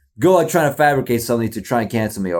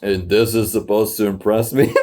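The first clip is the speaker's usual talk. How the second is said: low, unimpressed teacher voice; a sniffy teacher grading work; judgmental teacher; 6 semitones lower than usual. Pretend. Mocking himself as a judgmental teacher watching the video, unimpressed. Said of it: "like a freaking judgmental teacher".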